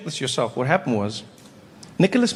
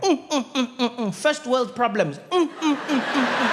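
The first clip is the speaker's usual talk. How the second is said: smug munching hum; 6 semitones higher than usual. Retold or imitated.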